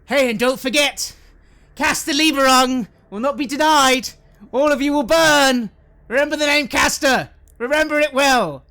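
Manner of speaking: high, defiant shouted threat; loud calling out. Acted. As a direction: bellow a menacing boast to those behind you. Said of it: "and then loudly call out behind him".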